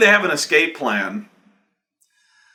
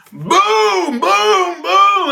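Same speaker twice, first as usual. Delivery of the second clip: high, loud mock gunshots one by one; three cartoon shots; 5 dB louder, much slower than usual. GM acting the troll shooting hostages, loud booms.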